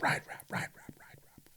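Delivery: fading whispered echo of the sponsor name. Acted. Host echoing the sponsor's name after the plug, fading whisper.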